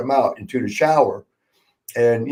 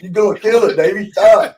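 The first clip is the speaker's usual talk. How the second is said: higher, louder alarmed shout; yelling stop; 7 semitones higher, 6 dB louder than usual. Retold or imitated. Friends shouting at Davey to stop throwing the snake, alarmed. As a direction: yell at him to quit. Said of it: "We're like".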